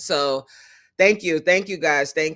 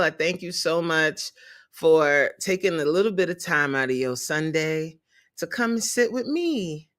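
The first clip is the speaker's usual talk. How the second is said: ordinary delivery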